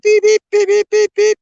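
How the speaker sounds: high, loud beeping of a metal detector; piercing electronic beep-beep. Retold or imitated. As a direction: chirp it out like the machine.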